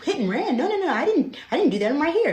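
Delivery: high-pitched, alarmed denial, acted out; panicked protest. Student denies a hit and run to the cop, panicked and high. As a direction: protest in a rising panic, retelling it.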